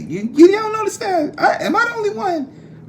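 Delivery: squeaky high falsetto question; high-pitched mock astonishment; mock disbelief. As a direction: feign shock in a tiny high voice.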